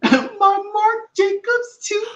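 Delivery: high, slow, squealing delight; squealing excitedly. Real, not acted. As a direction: gush over her, squealing. Gushes about a cast member.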